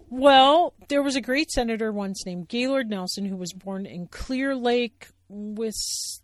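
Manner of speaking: loud, know-it-all bear; Sally Bear voice. Acted. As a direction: explain like a teacher. Sally explaining.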